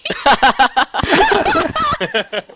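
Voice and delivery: high, loud delighted laugh; burst of laughter. Real, not acted. The streamer laughs at the chat's joke, high and loud. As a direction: crack up at the chat.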